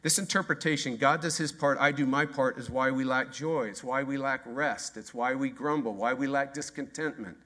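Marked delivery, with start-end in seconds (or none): none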